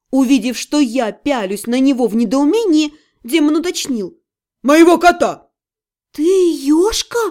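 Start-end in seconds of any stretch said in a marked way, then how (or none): 4.6-5.5 loud indignant correction; angry demon. Acted. Demon clarifying which animal was harmed, loud and angry. 6.1-7.2 slow, disbelieving question, rising at the end; stunned babble. A narrator's reading. Mage realising who the demon is, stunned faltering. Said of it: "пролепетала я".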